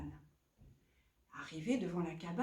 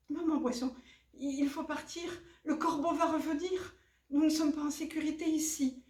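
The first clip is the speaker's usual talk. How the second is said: put-on urgent young fish; 6 semitones higher than usual. Son urges mother to flee.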